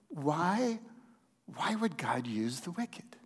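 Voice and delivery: a whispered why, asked under the breath; hushed question. Acted. Pastor to the congregation, voicing Habakkuk's doubt in a whisper.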